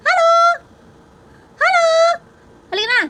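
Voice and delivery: pet-talk shout; high-pitched calling. Real, not acted. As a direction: call the pet in a very high, loud sing-song.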